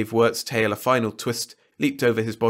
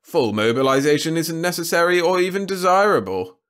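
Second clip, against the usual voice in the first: impatient; 8 semitones higher, 6 dB louder than usual. A narrator's reading. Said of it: "interrupted with some impatience".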